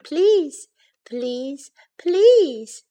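drawn-out begging, first and last pleas soaring. A narrator's reading. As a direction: plead like an insistent child. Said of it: "begged Baby Bear".

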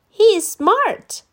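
put-on high, slow boy voice; proud Danny. A narrator's reading. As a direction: boast about your pet. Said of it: "said Danny".

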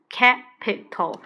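capital said slowly, each syllable clear; teacher modelling capital slowly; slow spelled-out capital. Shown as a demonstration. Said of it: "按照它的发音去背".

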